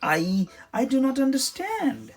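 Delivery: high, halting and puzzled; confused warrior. A narrator's reading. Reptilus admits to Trixie he is lost, high and halting. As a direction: stumble as if the idea is foreign.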